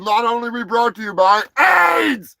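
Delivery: long, high hammed-up shout; mock announcer shout. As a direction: belt it out like a sponsor name. Mock sponsor read, shouted punchline.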